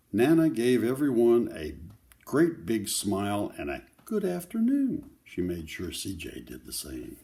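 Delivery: put-on steady read-aloud voice for children; story narration. A narrator's reading. Grandparent reading a picture book to children, gentle read-aloud. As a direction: read the story to the kids, clearly.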